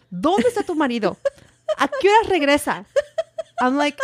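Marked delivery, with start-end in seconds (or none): 0.1-2.9 quick, high mom interrogation; fast put-on nagging; imitating fussing mom. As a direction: fire off questions like a flustered mother. Imitates Mom's anxious questioning.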